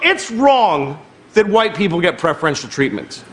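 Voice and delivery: usual speaking voice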